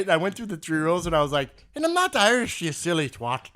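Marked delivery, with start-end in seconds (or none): 1.7-3.5 mock-scolding in an Irish brogue; cheeky fake Irish retort; Irish accent. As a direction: put on an Irish accent, starting high and loud. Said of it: "with an Irish accent".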